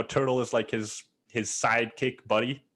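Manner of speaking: ordinary talk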